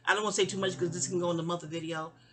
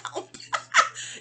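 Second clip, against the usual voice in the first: high burst of laughter; 5 dB louder than usual. Real, not acted. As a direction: break up laughing at the memory. Tickled by retelling mother's words.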